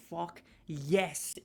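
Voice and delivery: ordinary talk, nothing marked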